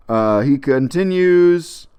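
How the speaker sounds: ordinary delivery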